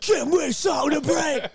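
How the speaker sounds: high, wailing fake sob; hammed-up crying demand; mock bawling. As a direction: wail it like sobbing into angry music. Mock bawling, angry music.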